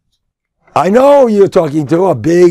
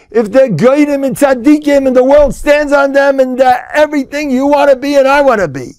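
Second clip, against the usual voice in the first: high, sustained cry from the pulpit; fervent, pounding exhortation; impassioned preaching; 10 semitones higher, 4 dB louder than usual. Real, not acted. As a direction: declaim it at a high, insistent pitch. Preaches at full force.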